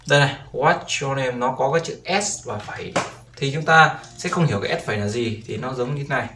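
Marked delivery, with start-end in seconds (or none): none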